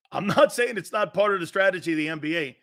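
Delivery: ordinary delivery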